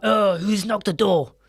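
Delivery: loud, grumbling cartoon villain; cartoon boss. Cartoon boss asking his pigs who's there, loud and put-on. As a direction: grouse loudly like the boss being disturbed. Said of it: "Or he'd be like".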